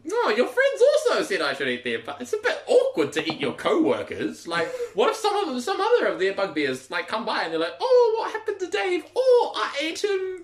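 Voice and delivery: very high, loud creature voice; awkward female creature. Acted. Creature fretting about eating co-workers, high and flustered. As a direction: squeak it out, flustered and half-laughing. Game master plays a creature.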